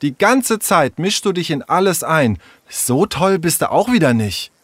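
high-pitched, accusing attack; quarrelsome. Acted. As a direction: turn on her, scolding. Tessa turns on Sarah in quarrel. (streitlustig, mit hoher Stimme)

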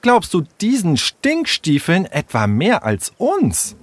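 high, slow, emphatic indignation; worked up. Acted. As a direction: challenge your friend in exasperation. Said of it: "regte Tessa sich auf".

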